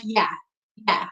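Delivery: casual New York "Yeah"; "yeah" said loosely in place of yes; slangy yeah. Shown as a demonstration. Speaker showing listeners her everyday slang, casual slang yeah. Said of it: "you'll hear me say a lot instead of yes is yeah".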